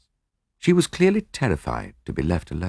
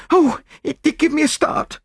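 very high, shaken maid's voice; startled maid. Acted. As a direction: exclaim as a maid after a fright. Maid recovering from a fright.

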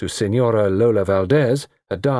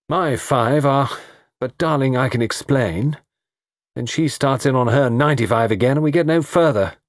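put-on higher-pitched, aggrieved young toff; animated, self-pitying account of a quarrel; lovelorn young man; 6 semitones higher than usual. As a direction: play the wronged sweetheart, lightly comic.

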